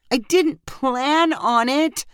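a touch of pained apology. A narrator's reading. Guilty friend confessing her date, pained. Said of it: "Lyrica replied painfully".

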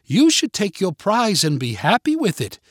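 emphatic British schoolmistress; British teacher. A narrator's reading. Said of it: "trying her best to sound like a British teacher trying to emphasize".

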